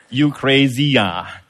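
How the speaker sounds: exaggerated Singaporean accent; Singlish accent. Shown as a demonstration. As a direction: show off broad Singaporean English. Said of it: "Singaporean English is like this".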